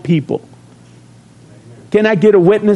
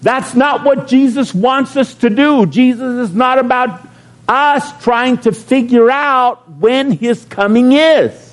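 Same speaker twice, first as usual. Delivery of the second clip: higher, urgent plea to the church; preaching wake-up call; 7 semitones higher than usual. Retold or imitated. Pastor calling out to all Christians, raised and urgent. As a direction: shake them awake with your voice. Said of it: "to all these Christians and say".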